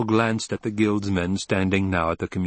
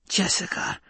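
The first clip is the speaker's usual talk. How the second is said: high, airy shocked whisper; 10 semitones higher than usual. A narrator's reading. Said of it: "she whispered".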